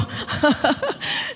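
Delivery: short, amused giggle; light real laughter. Real, not acted. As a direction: chuckle at your own story. Laughs at her retold comment.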